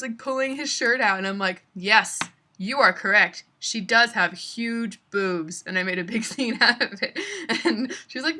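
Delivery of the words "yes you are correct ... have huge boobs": mock announcement. Loud mock agreement.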